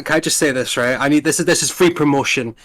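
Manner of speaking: ordinary talk, nothing marked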